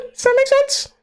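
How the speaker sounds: squeaky asking for agreement; high unsure question. Real, not acted.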